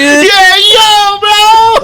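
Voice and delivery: high squealing bro whoop; frat bro. Retold or imitated.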